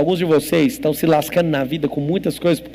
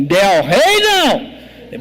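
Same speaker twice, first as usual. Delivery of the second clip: drawn-out, higher-pitched enraged roar; furious shout; 4 semitones higher, 4 dB louder, much slower than usual. Retold or imitated. Trainee in his seventies answers the nickname, furious shout. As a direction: bellow it like an imitation of someone enraged. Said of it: "ficou muito furioso e falou assim".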